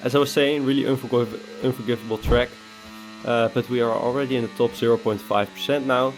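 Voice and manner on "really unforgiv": usual speaking voice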